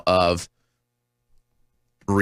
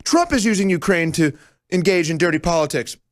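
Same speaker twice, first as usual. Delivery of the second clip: higher, whiny mocking voice; mocking Democrats; 6 semitones higher than usual. Host parroting Democrats' accusations in a high mocking voice. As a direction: parrot their talking points in a high sneer. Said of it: "The Democrats".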